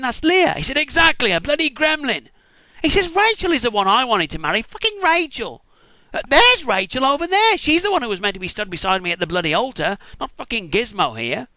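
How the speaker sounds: high, loud, furious ranting; outraged rant. Acted. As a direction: rage at being tricked. Jacob ranting about the wrong bride.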